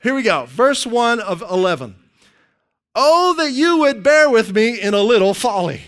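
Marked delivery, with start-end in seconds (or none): 2.9-5.8 loud, hammed-up mock plea; facetious. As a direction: read it as a grand tongue-in-cheek appeal. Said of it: "Paul's even facetious sometimes".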